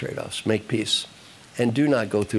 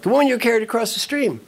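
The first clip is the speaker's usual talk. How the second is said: high, exasperated reminder; acted indignant monk; angry monk; 10 semitones higher, 3 dB louder than usual. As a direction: throw it at him in mock outrage. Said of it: "The angry monk".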